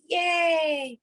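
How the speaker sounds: bright yay; happy yay; cheer. Shown as a demonstration. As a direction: cheer them on.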